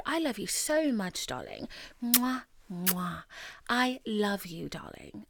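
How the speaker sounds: whispered love with air kisses; slow, breathy sign-off whisper; sweet nothings. Acted. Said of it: "As I always say at the end of every episode".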